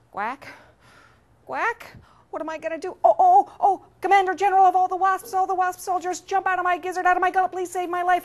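high, fast, loud plea; panicked duck. Acted. Duck surrounded by soldiers calls the wasps, high panic. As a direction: start fretful, then burst into a frantic summons.

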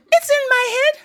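put-on very high, squeaky child's protest; insisting child. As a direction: squeal it back as a small kid.

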